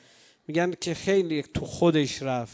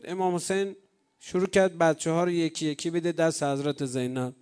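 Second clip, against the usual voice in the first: ordinary talk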